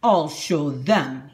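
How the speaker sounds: slow, grumbling determination; angry vow. A narrator's reading. Big Anthony vows revenge to himself, slow and resentful. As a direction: promise revenge under your breath. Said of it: "he said to himself".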